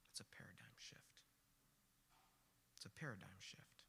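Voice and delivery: very low, near-whispered takeaway; hushed. Real, not acted. Preacher giving the story's lesson, hushed. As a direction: let it sink in, hushed and low.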